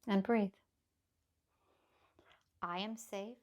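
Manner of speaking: hushed and steady, like a mantra; calm grounding exercise. Acted. Guided affirmations, softly.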